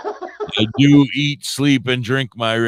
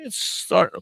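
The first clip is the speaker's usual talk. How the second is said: normal talking voice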